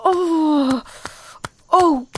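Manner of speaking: breathless moans building up, voice cracking; moaning. Acted. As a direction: let the moans climb, gasping between words.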